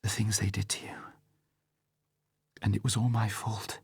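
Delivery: whispered, choked up; tearful whisper. A narrator's reading. Vesper grieving over Bond's wounds, whispered. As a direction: breathe it out through tears. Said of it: "Her eyes filled with tears".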